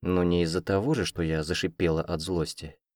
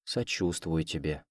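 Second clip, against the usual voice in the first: soft sympathy; 8 dB quieter, slower than usual. A narrator's reading. Selina offers sympathy softly.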